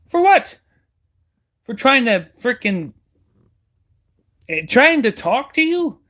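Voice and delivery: indignant high, loud questions; outraged. Real, not acted. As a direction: demand it in disbelief, pitch jumping up. Outraged at death threat.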